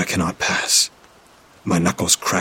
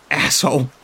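scream; 7 semitones higher than usual. A narrator's reading. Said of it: "he screams".

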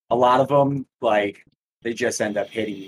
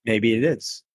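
robotic mic sound. Real, not acted. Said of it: "Still sound like a robot".

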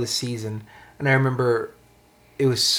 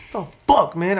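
higher, louder indignant burst; retold challenge, pitched up; ready to fight; 7 semitones higher, 4 dB louder than usual. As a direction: act out squaring up for a fight. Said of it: "I was ready to fight".

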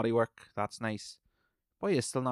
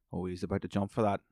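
usual speaking voice

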